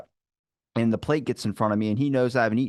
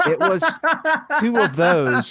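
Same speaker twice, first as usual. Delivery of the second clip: high, loud laugh through the words; 12 semitones higher, 5 dB louder, slower than usual. Real, not acted. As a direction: burst out laughing in disbelief. Laughing disbelief at tiny dish.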